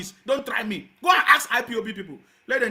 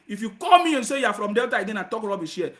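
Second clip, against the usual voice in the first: ordinary delivery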